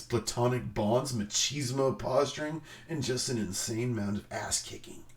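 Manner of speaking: ordinary talk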